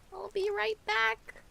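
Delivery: high sing-song call. Shown as a demonstration. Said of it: "repeats it sing-song".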